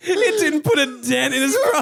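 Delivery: high, breaking into laughter; can barely get it out for laughing. Real, not acted. Telling a friend the story, laughing hard.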